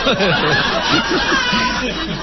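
big burst of high laughter. Real, not acted. Crowd bursting into laughter at the joke. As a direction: laugh hard at the punchline.

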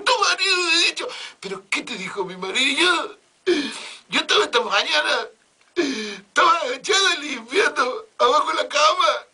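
high, loud mock sobbing maid; comic high-pitched blubbering; hammed-up wailing. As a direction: wail it like a hysterical maid. Maid recounting doctor's advance.

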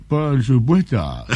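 drawn-out, unhurried answer as the famous Japanese lover; slow Japanese man. Acted. Japanese record holder answering the interviewer, slow smug drawl. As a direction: savour each word, slow and smug.

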